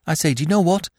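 high, insistent, agitated demand; agitated urgency. A narrator's reading. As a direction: order them out urgently, pitched high. Freddy urgently orders Smith out.

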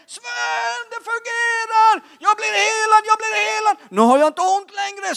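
high, ecstatic yell; very high-pitched, full-throated cry; imitated scream. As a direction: scream the news of being healed. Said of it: "Och sen skrek".

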